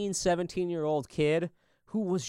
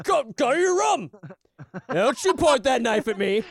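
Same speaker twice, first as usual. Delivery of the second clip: higher, louder hammed-up scolding father; mock stern dad; 5 semitones higher, 5 dB louder than usual. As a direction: play-act a dad sending her to her room.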